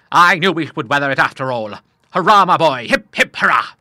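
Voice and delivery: the Admiral cheers his son's good news at full voice; bellowed cheers. A narrator's reading. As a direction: cheer like an old sailor on deck. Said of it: "bellowed out three stentorian cheers".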